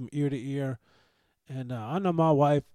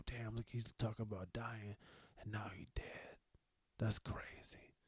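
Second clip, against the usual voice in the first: imagined gossip whisper; 5 semitones lower, 15 dB quieter than usual. Retold or imitated. Imagined future listeners gossiping.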